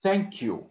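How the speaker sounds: "thank you" with a breath blown through the th. Shown as a demonstration. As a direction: model the th in "thank you" for students. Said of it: "you blow and make that sound".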